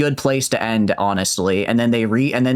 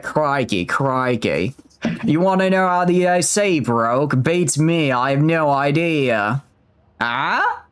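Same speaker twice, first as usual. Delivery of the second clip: hammed-up Aussie accent, pitched up; Australian accent; 3 semitones higher, slower than usual. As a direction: do a playful, broad Australian accent. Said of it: "what if we try Australian now?".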